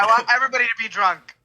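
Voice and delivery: loud, high, fast party demand; rowdy shout. Acted. Guest shouts to the party guests, loud and fast. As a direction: yell it to the whole room.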